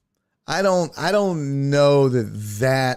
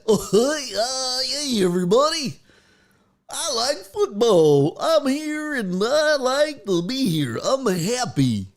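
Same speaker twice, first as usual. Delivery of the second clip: higher, hesitant puppet-coach voice; meek Schottenheimer; 7 semitones higher than usual. Retold or imitated. Host mocking Schottenheimer at the press conference, higher and hesitant. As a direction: play a nervous yes-man at the podium. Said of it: "he'll be like".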